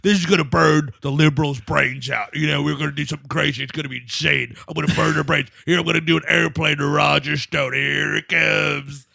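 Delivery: Alex Jones. Retold or imitated. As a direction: put on a low, manic rant, drawing out the last words. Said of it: "He's like".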